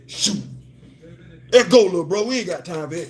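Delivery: quick, slurred laid-back friend voice; imitating Nick Thomas. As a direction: rattle it off like your homeboy. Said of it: "used to talk like this".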